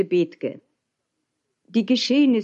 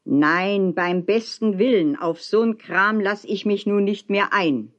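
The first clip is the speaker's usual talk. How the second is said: plain reading voice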